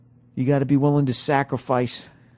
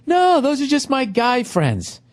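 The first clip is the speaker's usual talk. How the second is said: high, slow, sing-song mimicry of her excuse; slow mocking high voice, playing dumb; mock girlfriend voice; 11 semitones higher, slower than usual. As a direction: parody her innocent denial.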